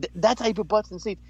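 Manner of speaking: high excited brag; hyped Taker impression. Taker boasting backstage, high and excited. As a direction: push it up, fired up and proud. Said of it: "And he's like".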